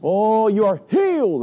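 high, loud, slow hammed-up healing pronouncement; mock faith healer. Pastor mocking a faith healer, slow loud hammed-up. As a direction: drag it out like a stage healer. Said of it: "they put their hand on their head and they go".

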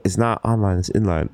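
mock correction, dropped low; correcting local. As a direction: imitate someone fussily correcting you. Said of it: "They're like".

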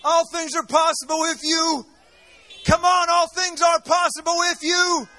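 shouting fiery sermon to the crowd; rally the congregation, loud and rousing; preaching at full force. Real, not acted. Preacher rousing his congregation, fiery shout.

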